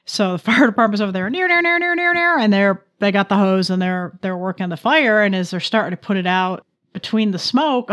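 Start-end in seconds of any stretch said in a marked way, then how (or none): 1.3-2.4 high wailing siren noise; siren imitation. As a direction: mimic a fire engine siren. Siren imitation by fire department.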